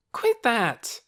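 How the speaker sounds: put-on high, loud girl's protest; cried out. A narrator's reading. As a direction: yell at him to stop. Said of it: "cried Lena".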